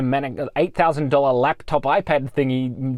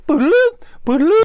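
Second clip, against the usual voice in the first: rising, much higher, louder "boodaloo" beep; imitated beeping; 14 semitones higher, 7 dB louder, much faster than usual. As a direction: mimic the tool beeping over the chat. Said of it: "It's driving me nuts".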